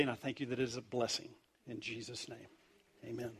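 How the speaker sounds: soft, low, intimate prayer. Real, not acted. Pastor thanks God for his word, quiet prayer.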